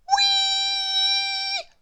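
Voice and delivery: very high shrill whistle imitation; whistle sound. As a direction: screech like a whistle. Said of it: "whistled the whistle".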